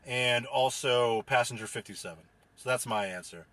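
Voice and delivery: usual speaking voice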